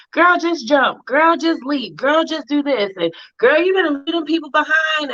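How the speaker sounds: put-on high, emphatic girlfriend pep talk; mock hype advice. Friend hyping a stuck woman to just leap, high mock pep talk. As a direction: egg her on like a hype friend.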